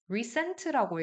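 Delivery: recent를 리센트처럼 또박또박 잘못 읽기; recent의 센을 에로 또렷이 틀리게; wrong reading. Shown as a demonstration. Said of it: "이 발음이 아니라는 거". (틀린 에 발음 시범)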